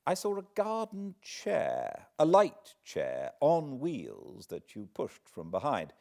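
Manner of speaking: expressive read-aloud storytelling with rising drama; story narration. A narrator's reading. Host reading Dickens aloud at the fireside, lively storytelling. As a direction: read it like a tale by the fire.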